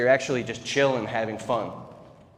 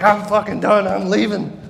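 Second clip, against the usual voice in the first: much higher, louder storming-out yell; angry shout; 8 semitones higher, 8 dB louder than usual. Retold or imitated. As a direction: throw it out like slamming the door. Storming out to get reaction.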